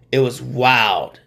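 it was wild drawn out slowly, each word weighted; slow emphatic repeat. Real, not acted. Host marvelling at a gruesome leg break, slow and weighty. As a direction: let it sink in, slowly.

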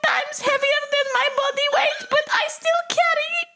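high, excited little ant; official ant voice. Pretend. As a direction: play a hungry tiny ant. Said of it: "official ant voice".

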